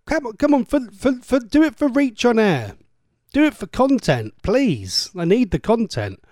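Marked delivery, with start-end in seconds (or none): 0.1-5.1 pleading. Real, not acted. Begging listener for content.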